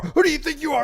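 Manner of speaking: high shouted mock whine. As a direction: shout it like a panicking agent. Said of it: "the federal agent's like".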